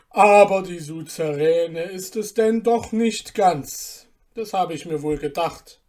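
a sly guest belittling the dish; mocking guest.

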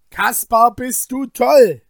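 high, loud shout like a madman; Wilm's crazed shout. Acted. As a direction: yell it as if out of your mind. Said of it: "schrie Wilm wie ein Wahnsinniger".

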